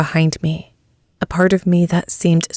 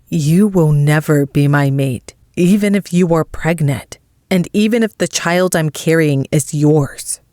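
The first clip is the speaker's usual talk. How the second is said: plain reading voice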